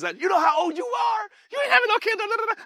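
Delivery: high-pitched mock disbelief; scoffing townspeople. Neighbours to old Zacharias about a baby, high-pitched scoffing. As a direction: jump up high and rattle off the objection.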